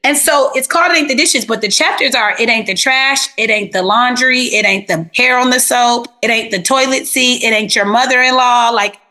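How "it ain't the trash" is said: ordinary delivery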